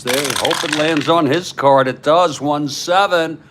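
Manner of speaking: play-by-play call. Acted. Host calling the tabletop game for viewers, energetic play-by-play. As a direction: call the action like a radio broadcaster.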